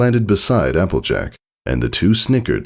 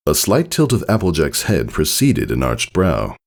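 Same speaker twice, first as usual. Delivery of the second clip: plain reading voice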